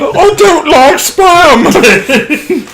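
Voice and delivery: very high, drawn-out falsetto wail; exaggerated comic falsetto screech; Monty Python old lady. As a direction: whine it slowly as a squawking Python housewife. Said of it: "turn this into nothing but quoting, like, Monty Python".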